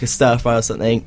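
ordinary talk, nothing marked

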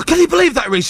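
very high-pitched incredulous appeal; retold outrage. Presenter seeking producer's support after row, retold incredulity. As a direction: appeal in disbelief, voice shooting up.

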